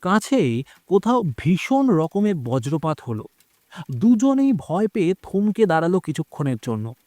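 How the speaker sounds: usual speaking voice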